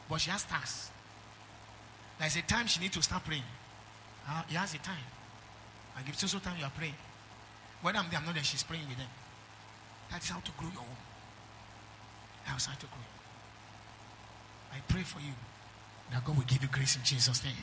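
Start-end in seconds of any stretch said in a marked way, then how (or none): none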